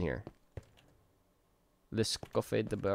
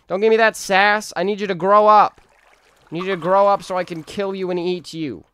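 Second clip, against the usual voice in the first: much higher, much louder scolding with mock menace; hammed-up angry yell; 9 semitones higher, 14 dB louder than usual. Streamer screaming at his baby chicken to grow so he can eat it, mock rage. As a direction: bellow at the chick like a hungry monster parent.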